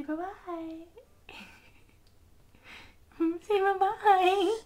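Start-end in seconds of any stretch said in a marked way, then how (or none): 3.2-4.7 bursting out laughing. Real, not acted. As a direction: laugh through the words. Laughing while saying goodbye.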